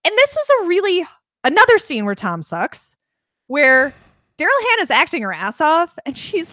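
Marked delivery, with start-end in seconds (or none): none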